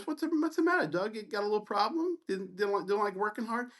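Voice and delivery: high, babyish mock concern. Resident needling the student who just left his rotation, mocking. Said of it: "he just continues to mock me".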